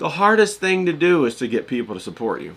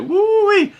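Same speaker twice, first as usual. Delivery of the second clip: excited whoop; 7 dB louder than usual. Real, not acted. Marveling at Southern women cussing.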